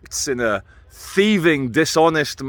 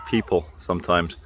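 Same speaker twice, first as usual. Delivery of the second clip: usual speaking voice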